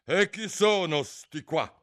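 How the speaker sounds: high, loud, slow demand; imperious queen. A narrator's reading. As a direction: question them like an irritated queen. Queen demands who gardeners are. (regina imperiosa)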